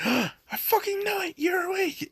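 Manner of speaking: high, triumphant screech; caught-you shriek. Retold or imitated. Katsuka sits up and catches Izuku faking sleep. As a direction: snap up high, catching him out.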